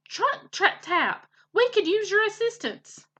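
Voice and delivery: high, hollering summons; hollered call. A narrator's reading. Mister Beaver summons his woodpecker friend, hollering. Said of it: "threw back his head and hollered".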